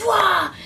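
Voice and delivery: high loud burst of a cry; surprised whoosh cry. Acted. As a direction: pop out with a yell. Unicorn bursts out of tree.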